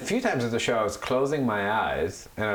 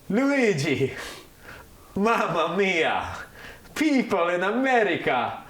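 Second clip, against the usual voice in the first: much higher, slow, exaggerated Italian accent, louder; hammed-up Italian accent; 12 semitones higher, 4 dB louder, much slower than usual. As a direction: ham up a cartoon Italian accent for laughs.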